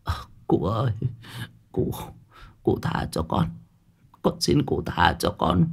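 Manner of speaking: faint, breathless plea, fading into whisper; feeble whispering. A narrator's reading. Beaten old woman begging the official for mercy, faint and whispery. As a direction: beg weakly with hardly any voice left. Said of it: "Miệng bà thì chỉ biết thều thào".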